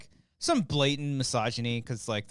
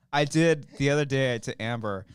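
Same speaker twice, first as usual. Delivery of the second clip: normal talking voice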